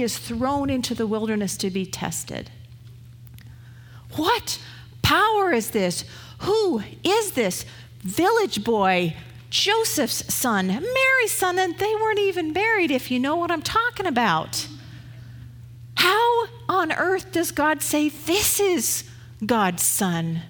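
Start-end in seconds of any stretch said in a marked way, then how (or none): 4.2-6.0 alarmed crowd. Acted. 6.4-20.4 scandalised questioning. Acted. Voicing the crowd's scandalised questions.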